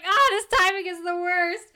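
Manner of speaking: high, drawn-up wail of mock despair; exaggerated high-pitched exasperation; mock dismay. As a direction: lament it in a high, hammed-up voice. Acting out his dismay at timing.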